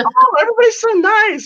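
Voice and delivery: mock naive self. A visitor in Tennessee mocks her old self as a naive Yankee, gushing high and loud. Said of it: "And I was like".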